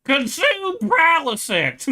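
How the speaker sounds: high, loud, drawn-out yell; quoted comic shout. Retold or imitated. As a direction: bellow the catchphrase slowly, high up. Quoting show while cracking up.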